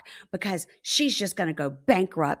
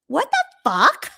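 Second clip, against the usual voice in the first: high, drawn-out mock outrage; stretched-out incredulous reaction; outraged disbelief; 8 semitones higher, 4 dB louder, slower than usual. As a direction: imitate a stunned, slow outburst. Acts her reaction to text.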